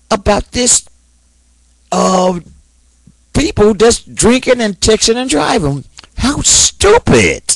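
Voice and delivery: high, slow disgusted anger; angry rant. Real, not acted. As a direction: spit it out in real disgust. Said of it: "and I am angry".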